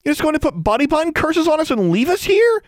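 high, loud outraged exclaim. A narrator's reading. Alexandra exclaims at Larry, outraged. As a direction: cry it out in disbelief. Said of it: "she exclaimed".

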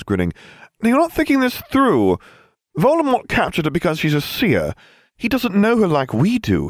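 put-on high, bright teen boy voice; cocky teen boy. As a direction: play it chirpy and oblivious. Harry's voice, cheery.

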